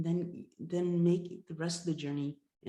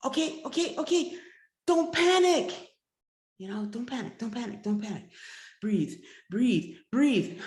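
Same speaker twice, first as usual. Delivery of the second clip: much higher and louder, frantic; panicked; 6 semitones higher, 11 dB louder than usual. Acted. Hunter in the freezing lake, panicking. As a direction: gasp it out in panic after falling through.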